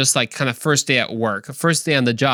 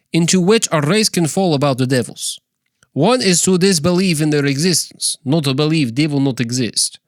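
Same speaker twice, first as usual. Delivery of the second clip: higher, put-on Russian accent; 3 semitones higher than usual. Shown as a demonstration. Podcaster reads the devil passage, mock Russian accent. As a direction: read it out in a hammed-up Russian accent. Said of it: "in a Russian accent".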